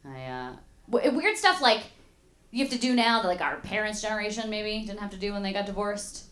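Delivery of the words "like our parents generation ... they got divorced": ordinary talk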